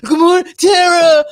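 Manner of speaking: high, booming shout; loud mock greeting. Imitating him coming in the house, loud holler. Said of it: "you be like".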